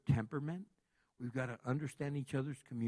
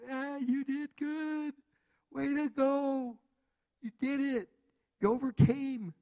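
higher, louder, slow cheering; self-praise; 10 semitones higher, 7 dB louder, slower than usual. Retold or imitated. Preacher acts a listener praising themself, high slow cheer. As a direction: cheer yourself on like a proud coach. Pat yourself on the back.

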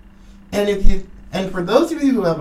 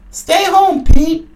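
loud, higher, barked command; angry shout; 8 semitones higher, 8 dB louder, slower than usual. Real, not acted. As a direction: order him to stay home, furious. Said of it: "Sorry, I'm mad today".

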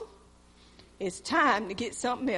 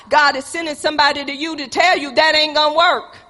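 loud, high, driving sermon voice; preaching at full force; 5 semitones higher, 8 dB louder than usual. Acted. Pastor warning the proud congregation, preaching loud. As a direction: hammer the warning home from the pulpit.

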